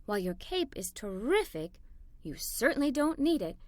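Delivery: a mother's soft bedtime words to her daughter; tender mother. A narrator's reading. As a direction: reassure her gently at bedtime. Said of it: "my mother said as she kissed me".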